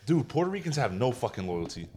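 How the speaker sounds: ordinary talk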